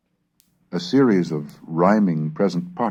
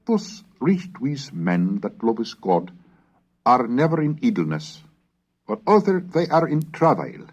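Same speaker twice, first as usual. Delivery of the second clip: higher, louder declaimed reading; raised, ringing reading of an old northern text; Middle English recitation; 12 semitones higher, 6 dB louder than usual. Acted. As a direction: proclaim it like a medieval preacher.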